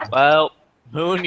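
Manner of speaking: ordinary talk, nothing marked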